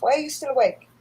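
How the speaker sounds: ordinary talk, nothing marked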